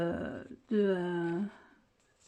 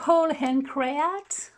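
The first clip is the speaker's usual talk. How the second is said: slow, showy attempt at an English accent; higher, drawn-out English-accented brand name; 4 semitones higher, 4 dB louder, much slower than usual. Shown as a demonstration. Vlogger showing off a shop name, put-on English accent. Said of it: "Oh, vous avez vu cet accent?".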